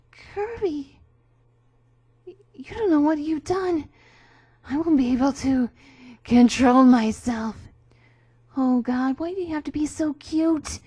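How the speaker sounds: loud, high, desperate pleading; hammy stage actor. Acted. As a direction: overwrought loss of control. Overcome by the poison.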